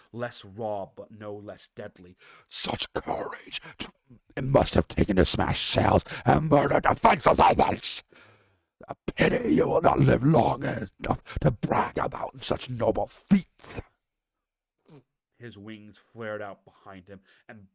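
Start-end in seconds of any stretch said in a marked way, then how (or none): 2.5-13.8 scornful dragon. Acted. Deathwing scorns the heroes, loud and sneering. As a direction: spit the praise back as mockery. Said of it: "grief had sharpened to scorn".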